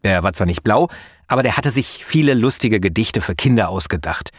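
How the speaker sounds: ordinary talk, nothing marked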